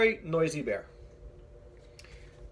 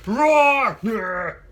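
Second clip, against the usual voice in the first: loud drawn-out bear roar; 4 semitones higher, 11 dB louder than usual. Acted. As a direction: let out a big bear roar.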